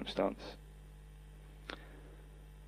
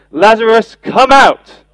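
ringing shout, high and loud; loud call; 9 semitones higher, 11 dB louder, slower than usual. Acted. Preacher voicing Jesus calling Lazarus from the tomb, shouting. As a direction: call him out of the tomb at full voice. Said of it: "Jesus called out in a loud voice".

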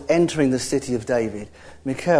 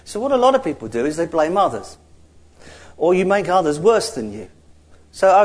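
normal talking voice

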